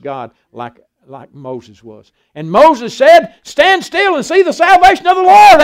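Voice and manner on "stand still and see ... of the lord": proclaiming command. Retold or imitated. Moses commands people at Red Sea.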